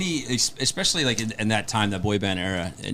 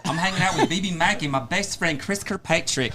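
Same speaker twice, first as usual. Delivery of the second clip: high-pitched campy Southern US caricature; camp Southern accent; 6 semitones higher than usual. Acted.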